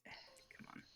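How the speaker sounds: hushed hope for a good roll; real whispered come on; whispered plea. Real, not acted. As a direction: whisper it to the dice. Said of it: "rolling dice, pleading".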